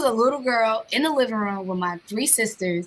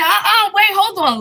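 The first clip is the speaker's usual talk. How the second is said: urgent protest; 8 semitones higher, 4 dB louder than usual. Retold or imitated. Refusing to give up her spot.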